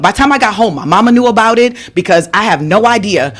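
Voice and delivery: usual speaking voice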